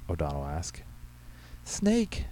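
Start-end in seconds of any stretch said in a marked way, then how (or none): 1.6-2.3 very high, thin squeak; frightened squeak. A narrator's reading. Secretary pointing at the cupboard, frightened squeak. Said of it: "Tammy squeaked".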